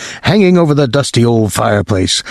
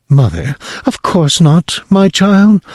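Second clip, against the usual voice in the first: mother's voice; 7 semitones higher than usual. Acted.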